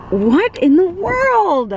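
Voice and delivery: drawn-out, high, astonished question; amazed disbelief. Real, not acted. Tourist gawking at strange roadside attractions, astonished. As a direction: stretch it out, stunned by the sight.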